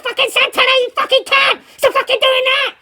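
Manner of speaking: high-pitched strained mock yell; retold screaming. Inmates below scream up at Santana, retold screaming. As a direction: yell it high, like angry neighbours imitated. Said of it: "and they're screaming".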